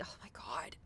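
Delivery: unvoiced, appalled whisper; whispered oh my God. Player whispering in disbelief at the chicken talk. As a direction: breathe it out in horror. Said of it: "I'm like".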